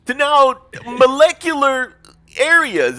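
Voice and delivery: loud, slow incredulous; exasperated disbelief. Real, not acted. Mock exasperation at friend.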